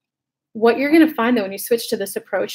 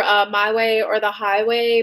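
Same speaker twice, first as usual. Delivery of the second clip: ordinary delivery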